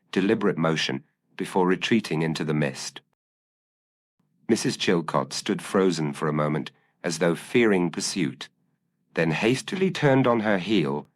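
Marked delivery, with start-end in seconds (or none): none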